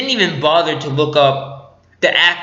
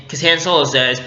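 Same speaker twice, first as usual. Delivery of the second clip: ordinary talk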